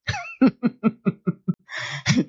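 long amused chuckling; rapid run of real laughter. Real, not acted. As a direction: burst out laughing at your own story. Laughs at own anecdote.

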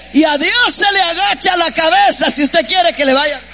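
forceful preacher in full flow; impassioned preaching. Real, not acted. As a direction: drive the point home to the congregation.

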